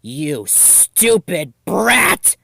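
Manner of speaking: seething, stretched-out rage; furious. Acted. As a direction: spit each word out in fury. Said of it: "She was furious".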